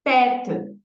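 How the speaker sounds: Pète with the E pushed hard and loud; Pète said with a strong, forceful E; stress on the E. Shown as a demonstration. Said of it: "a gente vai fazer força aí na letra E".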